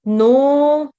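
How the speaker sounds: नो held on a long o; nooo, one long steady नो; long vowel. Shown as a demonstration. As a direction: stretch नो like a sustained chanted note. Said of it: "नो जो है वो भी दीर्घ है".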